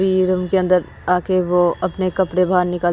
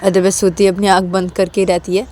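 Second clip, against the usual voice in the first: usual speaking voice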